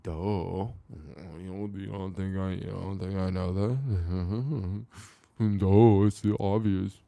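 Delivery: loud, slowed, sarcastic duh with a laugh; loud mocking scoff, laughing through the duh; exasperated mock retort. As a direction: throw it back at him like it's the dumbest question ever. Mocking reply to pointing out obvious.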